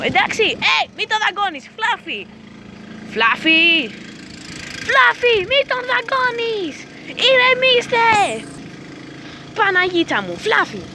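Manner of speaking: exasperated high-pitched yelling; frantic scolding. Real, not acted. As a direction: shout to stop dogs biting. Yelling at dogs biting each other.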